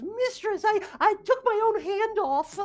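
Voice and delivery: very high-pitched imp whine; distressed little demon. Acted. Demon tells mistress his hand came off, high wail. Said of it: "He goes".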